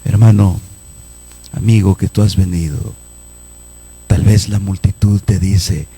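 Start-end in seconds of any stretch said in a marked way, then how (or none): none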